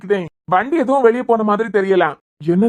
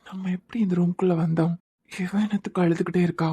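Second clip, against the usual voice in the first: puzzled murmur; 7 dB quieter than usual. Acted. Hungover man wondering how he got here, puzzled murmur. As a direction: murmur it privately, bewildered.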